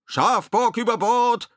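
slow, high, loud shout; drawn-out high roar; bellowed alarm. A narrator's reading. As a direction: holler the warning across the deck. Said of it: "brüllte Ed".

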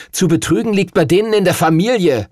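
put-on boy grumbling furiously at the cheat; angry scolding. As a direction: spit out the insult about his family. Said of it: "schimpfte Henry".

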